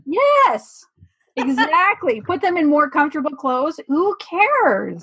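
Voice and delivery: high, bright and eager; excited agreement. Real, not acted. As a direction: cheer them on.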